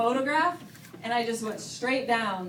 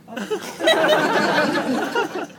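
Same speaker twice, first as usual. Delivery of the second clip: slow, high, laughing through it; 5 semitones higher, 6 dB louder, much slower than usual. Real, not acted. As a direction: giggle the words out slowly. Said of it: "I literally feel like talking like this right now".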